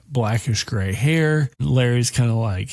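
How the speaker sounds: ordinary delivery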